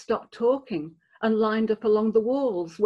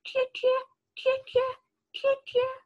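high whistled cheer cheer; excited starling whistle. Acted. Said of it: "The starlings whistled excitedly".

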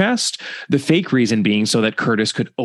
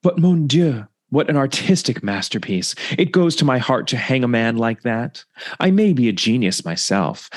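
put-on light French-accented detective; a hint of Belgian sleuth, savoring the words; Poirot voice. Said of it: "He says".

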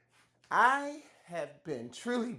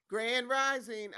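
giggling greeting, pitched up; laughing; 9 semitones higher than usual. Real, not acted. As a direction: say it through a laugh. Laughing greeting after mic trouble.

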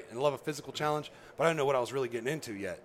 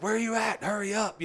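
retold yell; 8 semitones higher than usual. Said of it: "he's yelling at me".

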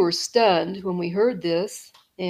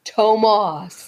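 louder drawn-out call; deep Sasquatch voice; 6 dB louder than usual. Retold or imitated. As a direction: imitate a creature calling a name. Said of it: "deep voice".

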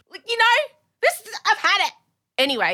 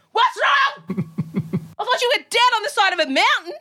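incredulous outburst; 9 semitones higher than usual. Retold or imitated. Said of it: "retold outburst at husband".

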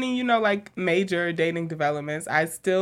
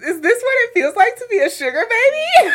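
laughing; 4 dB louder than usual. Real, not acted. Laughing at flirting story.

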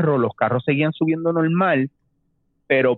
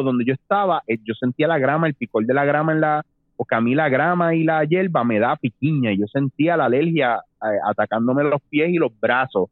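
usual speaking voice

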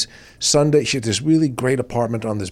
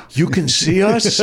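amazed; 6 semitones higher, slower than usual. Retold or imitated. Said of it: "I went".

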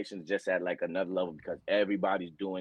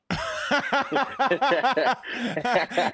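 loud, high laughing fit; big burst of laughter; 13 semitones higher, 9 dB louder than usual. Real, not acted. Two football guys joking about high school ball, loud laughter. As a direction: crack up loudly.

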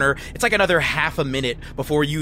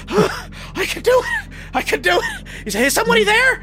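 scared squeaky voice. Acted. GM voices scared NPC pushing boxes.

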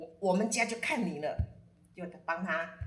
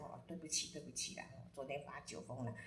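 much softer, lower sheepish sorry; sheepish apology; 5 semitones lower, 12 dB quieter than usual. Retold or imitated. Hungover client apologising to Guifei, soft and sheepish. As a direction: mumble it like the morning after.

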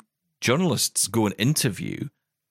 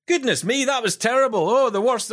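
entitled customer; 11 semitones higher than usual. Retold or imitated. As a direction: play an indignant customer demanding the manager. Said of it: "it happens to you and you go".

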